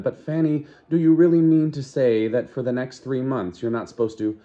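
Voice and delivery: put-on a light girlish Lenina, curious and doubtful; Lenina's voice.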